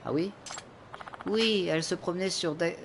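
hammed-up old woman recalling; old lady voice. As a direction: play a chatty old woman who just remembered.

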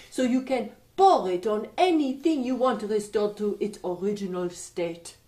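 put-on light French accent, playful stranger; a mischievous Frenchman teasing the king. French young man instructs king.

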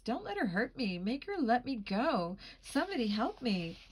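high, frantic plea for help; panicked pleading. Acted. Lily begging bystanders for help, panicked.

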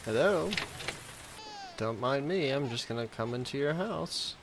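ordinary talk

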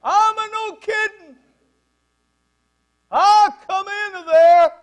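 booming, high, drawn-out Italian shout; irate Italian bellowing through the house; Italian accent yell. Retold or imitated. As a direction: holler it loud and slow with an Italian accent. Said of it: "That's Italian".

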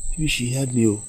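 ordinary talk

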